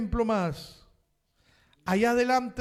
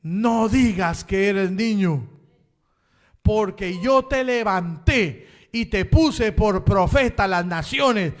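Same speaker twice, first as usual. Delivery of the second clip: voice of the Lord; 10 dB louder than usual. Retold or imitated. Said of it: "Y el Señor le dice".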